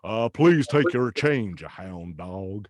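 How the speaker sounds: low, slow [name] drawl. Retold or imitated. [name] voice at Poundland self-service till, low and slow. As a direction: croon it like the king at a checkout. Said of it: "as the king".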